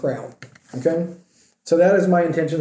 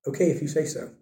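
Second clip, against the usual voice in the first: much softer, shrugging mumble; resigned mumble; 8 dB quieter, faster than usual. Retold or imitated. Disciples going along without understanding, softly resigned. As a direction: let it drop away, half under breath. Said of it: "They were just".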